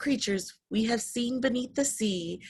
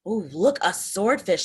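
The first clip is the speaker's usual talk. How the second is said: usual speaking voice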